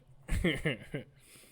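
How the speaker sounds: short burst of real laughter; laughing. Real, not acted. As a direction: laugh at the mangled text. Laughs at the translation.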